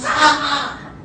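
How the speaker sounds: hammed-up scream of shock. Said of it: "he was screaming at the top of his lungs".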